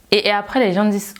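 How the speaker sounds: ordinary talk, nothing marked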